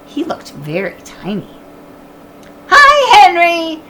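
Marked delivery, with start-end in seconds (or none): 2.7-3.8 high, loud cheerful shout; shouted greeting. Acted. Annie greeting the neighbor's dog, loud shout. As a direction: call out to the dog below. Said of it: "shouted Annie".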